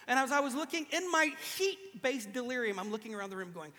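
usual speaking voice

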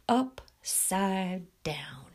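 very slow, breath-heavy, vacant echo; blank echo. A narrator's reading. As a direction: draw each word out emptily. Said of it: "echoed Sonny blankly".

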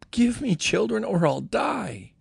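put-on a high, desperate plea for children; pleading. A narrator's reading. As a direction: beg him, voice rising high. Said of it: "She pleaded with Jacob".

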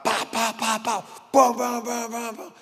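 quick high pa pa knocks on the door; mock knocking sound. Pastor imitating a policeman banging on the hotel door, high knocking sounds.

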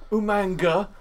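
high, loud mock British name call; put-on British accent. Recalling a wrestler impression, high mock British accent. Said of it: "what was the other one they used to do".

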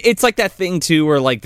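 ordinary talk, nothing marked

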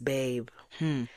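ordinary talk

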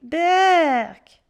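put-on grimacing boy's yuck; disgusted grimace. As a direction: spit it out in revulsion. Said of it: "fit Pierre en grimaçant".

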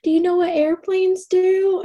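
put-on high, slow baby talk; sing-song baby talk to an infant; mom voice talking to baby. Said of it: "I was like".

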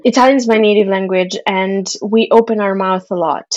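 ordinary talk, nothing marked

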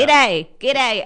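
put-on "Good day" shouted loud and high in a broad Australian accent; mock Aussie greeting. Guest mimicking overseas fans, loud hammed-up Australian accent. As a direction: belt out a hammed-up Aussie hello. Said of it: "everyone would be like".

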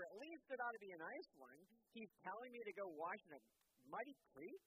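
high, loud, pompous whine; a spoiled general demanding a show; entitled. Acted. Naaman demanding royal treatment, entitled whine. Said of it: "he sounds a bit entitled".

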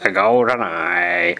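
slow, high showman announcement; dramatic title. Acted. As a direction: unveil it like a movie trailer.

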